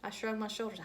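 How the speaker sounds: ordinary delivery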